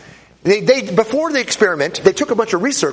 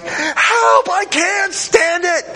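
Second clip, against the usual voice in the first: desperate cry for help; 12 semitones higher, much slower than usual. Retold or imitated. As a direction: yell like a man in pain begging to stop. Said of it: "the guy starts going".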